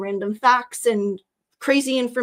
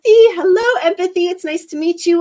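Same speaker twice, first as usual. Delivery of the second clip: bright greeting; 7 semitones higher, 9 dB louder than usual. Real, not acted. Greeting a new viewer.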